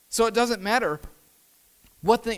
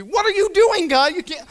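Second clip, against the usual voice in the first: high, loud outraged protest; Satan; 10 semitones higher, 6 dB louder than usual. Acted. Satan to God while watching the TV, outraged yelling. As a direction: yell in indignant disbelief, then break off. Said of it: "imagine Satan sitting there yelling".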